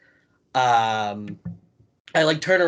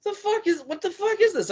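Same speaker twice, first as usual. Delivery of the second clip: put-on drunk Joey Janela. Storyteller mimics drunk Janela mocking his scarf, high and outraged. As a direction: ham up a drunk wrestler flailing at a scarf. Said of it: "He's like".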